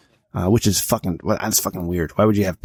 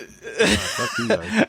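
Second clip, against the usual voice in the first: laughing; 8 semitones higher, 3 dB quieter, much slower than usual. Real, not acted. Laughing dismissal.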